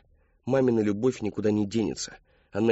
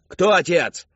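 loud, high, forceful demand; enraged shout; 9 semitones higher, 9 dB louder, slower than usual. A narrator's reading. Father demanding the name, furious shout.

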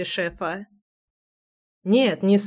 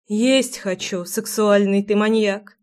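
usual speaking voice

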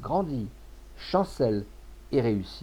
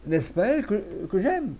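high, halting, bewildered question; flustered stutter in a raised voice; stammering; 8 semitones higher than usual. A narrator's reading. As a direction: stumble over the words, pitch climbing. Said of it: "dit le commis en balbutiant".

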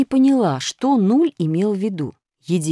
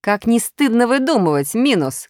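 loud scolding cry; shout; 7 semitones higher, 4 dB louder than usual. A narrator's reading. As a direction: call out in reproach. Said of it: "крикнула Пятёрка". (громкий укоризненный окрик)

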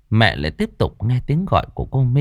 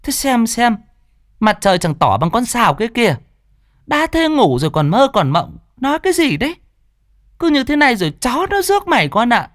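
put-on higher, fast nagging old mother's voice; scolding grandmother; 9 semitones higher, faster than usual. Grandmother scolding her oversleeping daughter, higher and nagging. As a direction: nag like an exasperated old mother. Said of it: "Bà ngoại trách".

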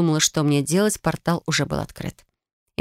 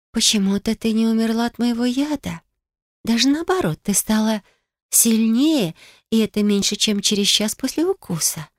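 admiring; 5 semitones higher than usual. A narrator's reading. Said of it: "восхищённо произнесла девушка".

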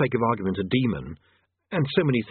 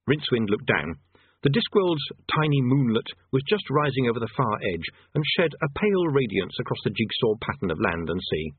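plain reading voice

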